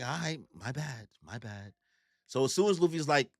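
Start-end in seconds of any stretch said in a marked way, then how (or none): 0.0-1.7 much softer, fading mumble; sheepish apology. Retold or imitated. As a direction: back down quietly, embarrassed. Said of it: "He's like".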